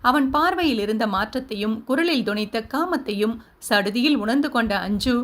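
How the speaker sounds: usual speaking voice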